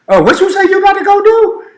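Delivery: high, loud shocked voice of a dinner guest; surprised question. Retold or imitated. Dinner guest reacting to talk of going to the head, shocked and high. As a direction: squeal it in disbelief. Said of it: "people over here thinking".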